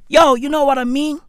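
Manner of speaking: ordinary delivery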